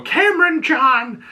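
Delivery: high, drawn-out full-name yell; scolding mother. Retold or imitated. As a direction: imitate a mother calling out a child in trouble. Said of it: "how my mother would go".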